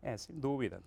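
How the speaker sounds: usual speaking voice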